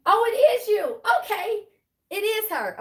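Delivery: light up at a familiar name; surprised delight. Real, not acted. Tarot reader spots best friend in live chat, delighted surprise.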